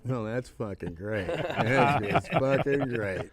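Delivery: slow, laughing sarcasm; sarcastic great. Retold or imitated. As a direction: drag it out with a bitter laugh. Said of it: "I'm like".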